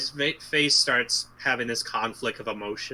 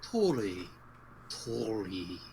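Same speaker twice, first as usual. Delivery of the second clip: weird voice; slower than usual. Pretend. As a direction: stretch each repeat out strangely. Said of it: "You sound so weird".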